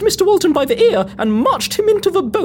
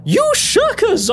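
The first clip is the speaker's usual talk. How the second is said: higher-pitched, drawn-out accusation; indignant cry; 5 semitones higher, slower than usual. Acted. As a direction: cry out in outrage at the deserters. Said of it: "I cried".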